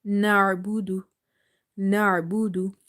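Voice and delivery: na'budu with the ain from mid throat; recited na'budu, throat ain. Shown as a demonstration. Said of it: "from the middle part of the throat".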